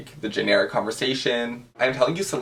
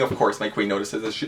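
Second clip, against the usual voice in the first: ordinary talk, nothing marked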